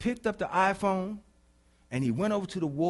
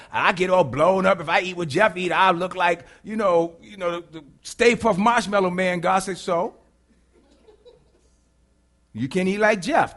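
loud, animated back-and-forth preaching; full-force preaching; 3 semitones higher, 9 dB louder than usual. Real, not acted. Preacher acting out complaint to God and God's reply, full-force preaching. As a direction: drive it home with forceful, punched phrases.

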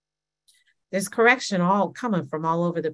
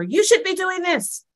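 much higher, mock scolding; mock correcting voice; 11 semitones higher than usual. Mimicking a critic's correction, much higher mock voice. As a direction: squeak it like a nagging critic.

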